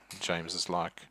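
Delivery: usual speaking voice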